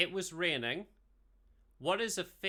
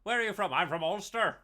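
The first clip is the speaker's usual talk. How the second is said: hammed-up Ulster accent; proud Northern Irish voice, loud; 4 dB louder than usual. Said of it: "I'm an Ulster man".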